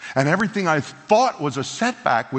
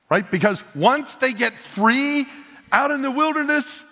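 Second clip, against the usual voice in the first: ordinary talk, nothing marked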